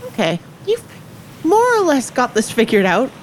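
ordinary talk, nothing marked

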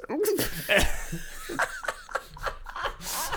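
high cackling laugh; burst of laughter. Real, not acted. Laughing hard after roasting the homeless guy. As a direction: break up laughing at your own joke.

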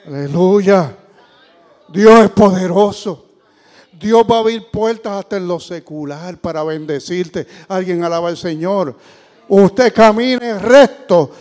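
slow, loud, drawn-out preaching; full-voiced sermon cry; fiery preaching. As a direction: proclaim it to the congregation.

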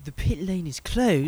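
slow, high, exaggerated British accent for "The pit lane is closed."; posh British accent. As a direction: play it like a stuffy British radio call. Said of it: "Sound like the Queen".

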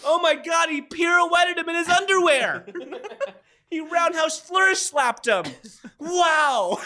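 shouting play-by-play in disbelief; excited fight commentator. Pretend. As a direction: yell it like a ringside announcer losing it.

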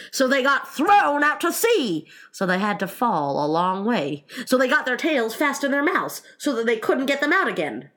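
loud high bursts between calmer phrases; emphatic Griffin. Acted. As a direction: hammer out each step of the explanation. Griffin explaining with rising force.

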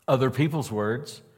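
ordinary talk, nothing marked

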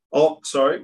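ordinary talk

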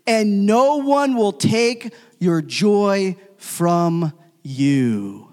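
slow and forceful, word by word; emphatic scripture reading. Acted. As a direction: draw out every word of the promise.

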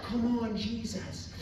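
high-pitched call without much volume; mild retold shout. Quoting his habitual worship cry, a mild high shout. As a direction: lightly imitate your own worship shout. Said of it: "I shout".

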